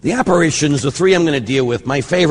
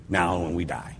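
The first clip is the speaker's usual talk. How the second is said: softer repetition of "now and when we die", said to let it sink in; quiet reflective echo; 8 semitones lower, 9 dB quieter than usual. Shown as a demonstration. Priest repeating the line to let it land, quiet and reflective. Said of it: "And that's a great thing".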